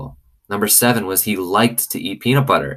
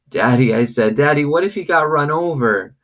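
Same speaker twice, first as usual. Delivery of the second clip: frightened plea; 8 semitones higher, 4 dB louder than usual. Acted. Frightened girl asking father.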